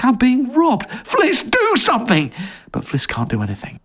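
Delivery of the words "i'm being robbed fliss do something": panicked plea. Acted. Jerry begging Fliss for help, panicked and higher. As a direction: yelp it out in alarm.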